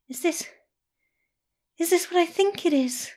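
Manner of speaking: high cooing voice, hopeful. A narrator's reading. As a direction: coo it, barely believing. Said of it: "cooing at him again".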